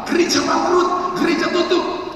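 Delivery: high, loud, drawn-out taunt; mocking cry, imitated. People declare the church finished, imitated in a high shout. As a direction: imitate doomsayers calling it out.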